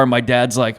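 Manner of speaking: ordinary delivery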